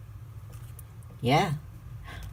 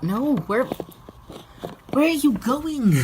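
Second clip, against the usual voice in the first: much higher, very slow, drawn-out surprise; stretched-out high where are you going; surprised pet talk; 12 semitones higher, 3 dB louder, much slower than usual. Real, not acted. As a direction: call after the wandering pet in disbelief. Pet wanders off mid-feeding.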